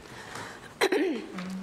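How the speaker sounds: clears throat harshly; rough throat clear. Real, not acted. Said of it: "get a little bit of my voice back".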